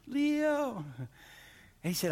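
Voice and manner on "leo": high, drawn-out call; distant calling voice. Retold or imitated. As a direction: imitate a far-off father calling his son. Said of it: "heard a voice way up going".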